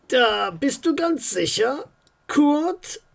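tense official on a phone call; a worried mayor, lightly voiced.

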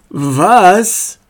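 a touch of astonishment; surprised. A narrator's reading. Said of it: "sah ihn überrascht an".

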